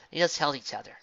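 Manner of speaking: ordinary delivery, nothing marked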